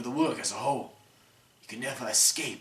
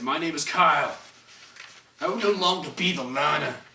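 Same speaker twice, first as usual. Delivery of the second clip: loud, ringing proclamation of a new self; proud declaration; 3 semitones higher, 9 dB louder than usual. Acted. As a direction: declare it loudly to the world. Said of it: "And he said".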